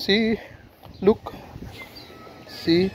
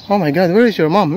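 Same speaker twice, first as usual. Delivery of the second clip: ordinary talk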